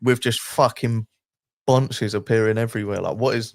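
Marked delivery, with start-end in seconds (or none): none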